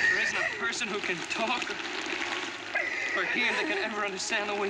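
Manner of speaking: sharing a happy breakthrough; joyful. Real, not acted. Joyful signing with pupil.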